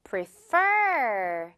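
"Prefer" with the second syllable stressed and a blown F; stress on FER. Shown as a demonstration. Teacher stresses the last part for students. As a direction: lean on the end of "Prefer". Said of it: "그 부분에 강세도 주면서".

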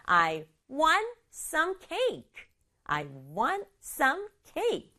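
very slow, "want" and "cake" picked out; stress on WANT and CAKE. Shown as a demonstration. Teacher models child's request, stressing "want" and "cake". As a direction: give "want" and "cake" the weight, falling at the end. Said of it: "이렇게 두 단어 강조하면 돼요".

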